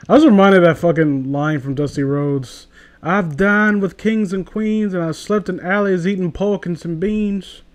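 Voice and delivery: ordinary talk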